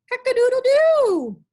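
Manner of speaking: high, loud cock-a-doodle-doo; rooster crow. Pretend. Teacher crows the rooster call for the children.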